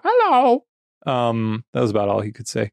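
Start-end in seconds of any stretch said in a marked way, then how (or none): 0.0-0.7 high squawky parrot hello. Retold or imitated. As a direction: chirp it like a parrot. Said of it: "He would go".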